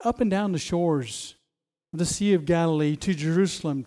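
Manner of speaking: ordinary talk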